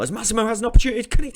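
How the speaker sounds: breathless commentator burst; excited commentary. Real, not acted. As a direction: race through it as the chance opens. Live commentary on a chance.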